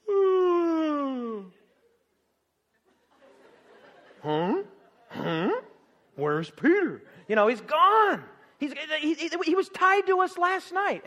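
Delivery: a touch of baffled guard; mild mock confusion; puzzled soldiers. Soldiers baffled Peter is gone.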